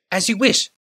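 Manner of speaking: careful servant choosing his words, pitched high; Hajar's voice. A narrator's reading. Hajar obeys the Kadir.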